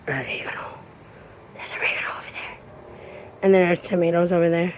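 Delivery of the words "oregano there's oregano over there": hushed unvoiced whisper. Real, not acted. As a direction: whisper it softly. Whispering during tour.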